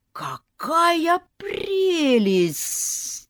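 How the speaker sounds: high, drawn-out sly gushing; hissing aunts. A narrator's reading. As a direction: gush greedily like snakes. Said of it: "шипели тётки".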